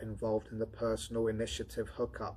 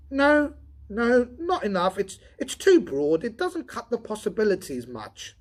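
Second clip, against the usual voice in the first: higher, louder voice for the redhead Donovan; Donovan voiced higher; 11 semitones higher, 10 dB louder than usual. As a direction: lift into the tired redhead's voice.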